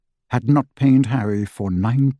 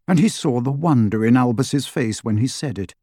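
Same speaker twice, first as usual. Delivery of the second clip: plain reading voice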